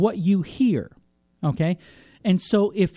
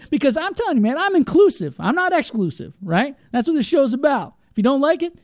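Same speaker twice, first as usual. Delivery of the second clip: fired up; 8 semitones higher than usual. Real, not acted. Host gets fired up, inclusive rant.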